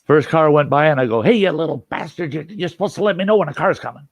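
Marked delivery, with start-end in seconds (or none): 1.2-4.1 higher, irritated telling-off; mildly exasperated scolding; acted scolding. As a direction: tell off a useless lookout. Scolds lookout in the story.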